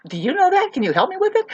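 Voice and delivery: mock pleading. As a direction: play dumb in a high voice. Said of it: "It's like".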